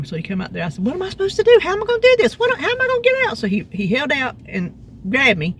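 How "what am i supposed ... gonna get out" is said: high, panicky questions; retold panic. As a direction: voice yourself stuck and helpless, pitched way up. Stuck on slick porch, panicking.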